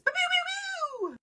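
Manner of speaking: high, slow pew-pew blaster noises; laser gun sounds. Acted. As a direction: play the ray gun sound effect. Mock laser blasts after intro.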